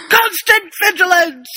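sudden high, loud shout; Moody's yell. Acted. Podcast host acts out Moody's startling outburst, yelled. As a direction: bellow it out of nowhere to startle. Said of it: "and Moody yells".

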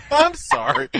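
apologizing through laughter; giggly, breaking up; laughing. Real, not acted. Giggling fit mid-podcast.

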